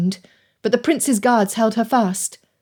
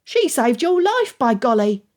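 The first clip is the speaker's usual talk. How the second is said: put-on child voice; 3 semitones higher than usual. A narrator's reading. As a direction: protest like a small boy. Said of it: "Jim's childish voice rose in protest".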